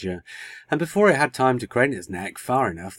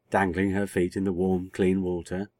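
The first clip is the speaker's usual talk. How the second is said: usual speaking voice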